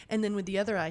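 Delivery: ordinary delivery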